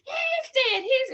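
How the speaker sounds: high, loud, panicked wailing; mock hysterical scream. A woman screaming the injured man is dead, mock panicked shriek. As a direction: shriek it like a frantic bystander. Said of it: "she was screaming".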